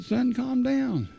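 lightly voiced pastor, drawn out and calming; imitating the pastor. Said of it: "This was your pastor doing this".

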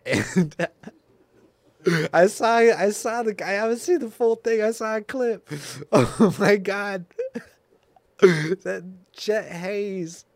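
high, loud, helpless laughter through the words; wheezing with real laughter; laughing fit. Real, not acted. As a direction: crack up so hard the words barely come out. Cracking up over a clip.

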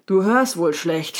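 slow, mildly huffy remark; a touch of snorting annoyance. A narrator's reading. Annoyed unicorn to a raccoon who keeps asking, mildly snorting. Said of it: "schnaubte das Neinhorn".

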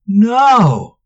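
sharp loud shout of NO. A narrator's reading. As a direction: yell it out in protest. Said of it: "Manx shouted".

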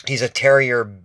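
usual speaking voice

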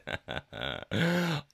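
light laugh at a slip; laughing at mistake. Real, not acted. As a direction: laugh off the misreading. Laughs at misread line.

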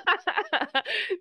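bright high laughter. Real, not acted. Laughs at joke.

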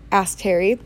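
usual speaking voice

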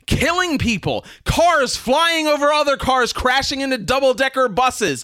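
worked-up rant. Real, not acted. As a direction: rail at foolish pedestrians, getting carried away. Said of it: "Oh, I am so worked up".

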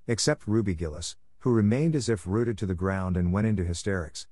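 ordinary delivery

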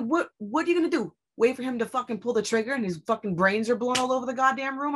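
rapid exasperated rant; incredulous outrage. Retold or imitated. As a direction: challenge them in disbelief, racing through it. Retold argument over emergency.

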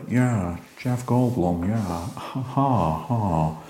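a light, laid-back take on a famous actor with a chuckle at the end; relaxed, jolly, trailing into a soft laugh; Jeff Goldblum voice. Retold or imitated. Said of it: "It's my Jeff Goldblum in, uh, impression".

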